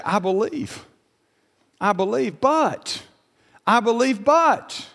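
"but" pitched high after a pause; preacher's quote, "but" leaping upward; emphatic quoted excuse. Retold or imitated. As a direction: imitate a believer's excuse, leaning hard on "but". Voices hesitant believers, preaching emphatically.

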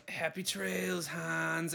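slow, quiet action-hero one-liner; a hint of John McClane, drawn out; a lightly voiced movie hero. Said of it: "that's my John McClane kinda thing".

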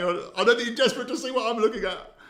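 laughing while talking; words through laughter. Real, not acted. Laughing while searching clip.

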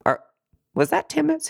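can't believe the time flew by; shocked disbelief. Retold or imitated. To the yoga teacher, retelling her shock, high and incredulous. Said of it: "I was so shocked".

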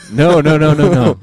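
real laughter breaking in; laughing protest. Real, not acted. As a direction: laugh through the denial. Laughing at his own joke.

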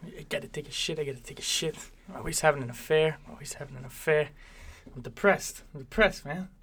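lightly voiced Italian mobster, every line twice; half-hearted Italian wiseguy accent, repeating each line; mock Italian mobster. Johnny Two Times to the crew, mock Italian mobster accent. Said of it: "he says everything two times".

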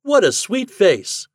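high, loud, slow exclamation. A narrator's reading. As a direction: draw it out as you exclaim. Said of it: "exclaimed Fat".

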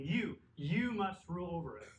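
"You" stressed, then a firm fall; emphatic pronoun. Shown as a demonstration. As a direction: hit "You" hard, like a command. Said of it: "It's emphatic".

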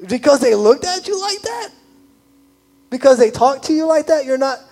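Jesus baffled at the petty grudge; Jesus in disbelief. Pretend. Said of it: "And Jesus is like".

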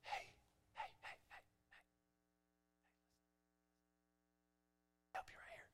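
whispered, barely audible; a tiny voice pleading for attention; still small voice. Pretend. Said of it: "the Holy Spirit is trying to say".

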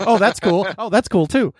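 high, loud mock excitement; distracted enthusiasm. Joking about losing focus when older, high distracted enthusiasm. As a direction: get freshly excited by each passing thing. Acting out easily distracted adult.

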